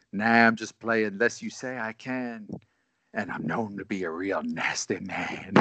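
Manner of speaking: a hint of a low, creepy whisper; right in your ear. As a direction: murmur it low and sleazy, up close. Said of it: "less rapey voice".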